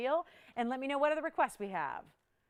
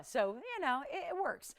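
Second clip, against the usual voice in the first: normal talking voice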